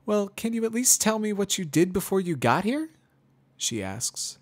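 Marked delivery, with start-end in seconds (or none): 0.0-2.9 much higher, louder, openly annoyed; Twilight, annoyed. A narrator's reading. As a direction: ask through clear irritation. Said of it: "not disguising the annoyance in her tone".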